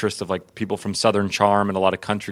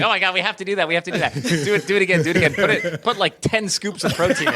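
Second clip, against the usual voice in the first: excited laughing director; 8 semitones higher, faster than usual. Retold or imitated. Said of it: "He's like".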